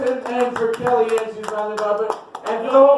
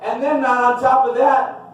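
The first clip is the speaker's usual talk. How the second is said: ordinary delivery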